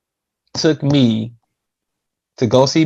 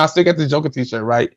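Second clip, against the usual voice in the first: ordinary delivery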